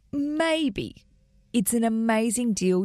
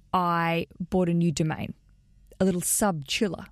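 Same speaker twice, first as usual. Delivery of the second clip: normal talking voice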